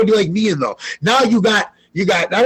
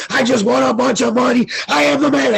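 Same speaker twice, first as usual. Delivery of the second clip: hyped brag; 6 semitones higher than usual. Retold or imitated. Bragging live on Brosco's stream, excited higher yell. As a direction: crow about the win, climbing in pitch. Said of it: "I went nuts".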